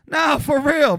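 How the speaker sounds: ordinary talk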